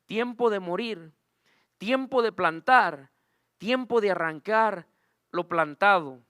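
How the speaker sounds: slow, loud scripture reading; measured, emphatic Bible recitation. Acted. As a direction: proclaim each phrase slowly, with weight. Reads scripture aloud.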